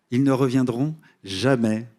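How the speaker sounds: ordinary talk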